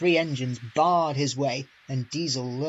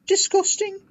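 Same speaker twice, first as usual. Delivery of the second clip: squeaky disgust; 7 dB louder than usual. A narrator's reading. Put in James.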